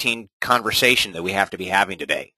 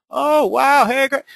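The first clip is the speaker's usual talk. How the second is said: much higher, louder mock delight; delighted neighbor; 13 semitones higher, 4 dB louder, much slower than usual. Neighbor impressed by the open house, gushing high. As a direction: play the thrilled neighbor.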